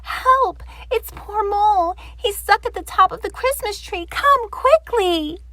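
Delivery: a frightened rabbit running for help; panting Rabbit. Acted. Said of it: "panted Rabbit".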